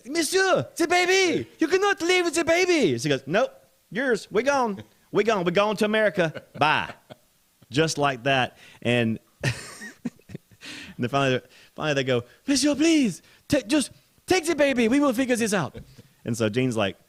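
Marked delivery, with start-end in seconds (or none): 0.1-3.0 high, loud mock French alarm; panicked French accent. Acting alarmed French gate agent calling out. 3.3-6.9 high, loud mock send-off; breezy refusal. Acting Gene breezily walking off. 12.5-15.8 put-on high, loud French-accented begging; pleading French accent. Acting French agent pleading.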